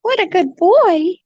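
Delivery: high cooing praise. Real, not acted. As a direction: coo it at the bird.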